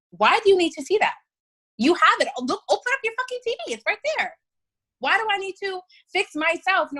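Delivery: fast, high, indignant outburst; exasperated fast rant at the execs; heated rant. Real, not acted.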